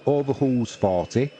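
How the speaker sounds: ordinary talk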